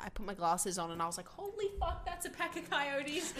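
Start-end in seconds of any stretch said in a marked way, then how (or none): 1.3-3.3 high, hushed disbelief; retold shock. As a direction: re-enact a stunned realisation, high-pitched and held back. Retelling shock at seeing coyotes.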